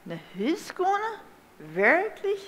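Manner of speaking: high-pitched, rising questions of disbelief; narrated mother, taken aback, pitch leaping up; quite astonished. A narrator's reading. Mother questions son about going home, astonished. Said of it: "Ganz verwunderd".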